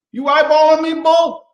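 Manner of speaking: high, loud taunt at the bull; mock tough guy rodeo accent; mock challenge. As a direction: square up to the bull, hammed-up. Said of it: "I got this accent going".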